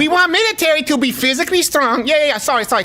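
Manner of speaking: high mock Chinese accent; broken-English Chinese accent; Chinese military leader impression. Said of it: "an impression of a Chinese military leader".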